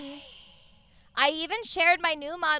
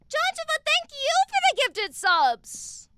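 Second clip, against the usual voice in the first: excited squeal; 12 semitones higher, 8 dB louder than usual. Real, not acted. Streamer squealing thanks at a donor for gifted subs. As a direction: call the name out with delight.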